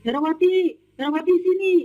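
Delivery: high-pitched imitated summons; Erin calling out. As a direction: call the maid up from downstairs. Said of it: "saya dipanggil sama Bu Erin". (menirukan majikan memanggil dari bawah)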